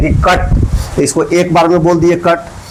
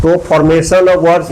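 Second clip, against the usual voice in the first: normal talking voice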